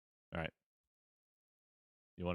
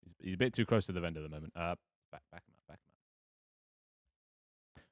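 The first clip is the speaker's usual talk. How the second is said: ordinary delivery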